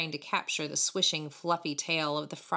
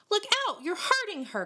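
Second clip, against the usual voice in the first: high, urgent warning; sharp caution. A narrator's reading. David protecting the kitten from pain, sharply. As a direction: warn them sharply in alarm. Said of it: "cautioned David sharply".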